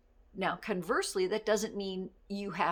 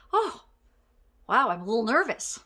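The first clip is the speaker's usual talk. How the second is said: nervous admission; 2 semitones higher, 10 dB louder than usual. Shown as a demonstration. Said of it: "you can say".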